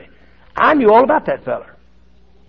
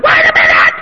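a sudden, much higher, louder cry; desperate cry; 8 dB louder than usual. Retold or imitated. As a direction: yell out for help from behind the bars. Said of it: "cry out to me like that".

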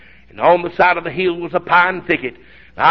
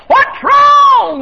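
high, loud call from far off; hollered question; 7 dB louder than usual. Retold or imitated. Wife calling from the fence to her husband. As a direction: holler it across the yard. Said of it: "ran to the fence and hollered".

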